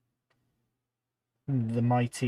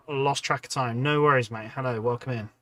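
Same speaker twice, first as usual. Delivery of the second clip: ordinary talk, nothing marked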